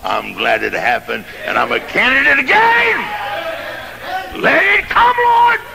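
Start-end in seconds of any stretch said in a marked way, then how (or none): 1.8-5.6 soar up into the whoop; preaching whoop. Acted. Preacher rising to climax.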